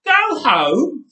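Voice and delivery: high loud disbelieving shout; outraged shout. A narrator's reading. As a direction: yell it back in outrage. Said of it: "he shouted".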